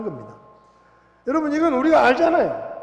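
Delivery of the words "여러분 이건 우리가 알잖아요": preaching at full force. Acted. Preacher raises voice to congregation.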